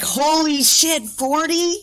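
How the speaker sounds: high, drawn-out mock shock; stretched, high-pitched incredulous reaction; shocked disbelief. Acts out shock at turning forty.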